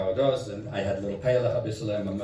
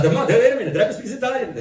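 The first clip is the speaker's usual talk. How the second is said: much higher, amazed double take; surprised realization; 12 semitones higher, 4 dB louder, faster than usual. Retold or imitated. Boy realizing the Rebbe understood his Italian, surprised and much higher. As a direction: catch yourself mid-sentence in disbelief.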